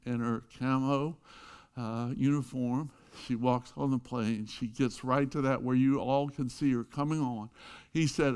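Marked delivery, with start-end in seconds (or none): none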